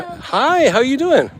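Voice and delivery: squeaky high hello to the cats; high cat-greeting voice. Real, not acted. Greeting street cats in a high pet voice.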